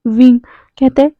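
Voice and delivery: ordinary delivery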